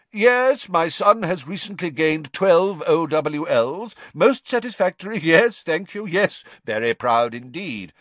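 high, loud, brisk ministry voice; dictating to an imaginary clerk; pompous official. Acted. Deluded Crouch chats to a tree as if to his clerk, pompous and brisk.

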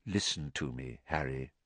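put-on soft, low old headmaster; gentle. A narrator's reading. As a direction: draw him in quietly. Dumbledore quiet, gentle.

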